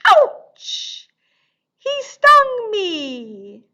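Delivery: high loud mock yelp then slow whine; hammed-up hurt cry for kids; mock ouch. Stung by bee in song.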